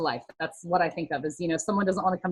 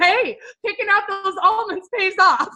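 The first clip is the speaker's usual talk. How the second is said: laughing through the words, pitched higher; real laughter breaking into the line; laughing comeback; 11 semitones higher, 4 dB louder than usual. Real, not acted. Telling followers her comeback to a teasing friend, laughing.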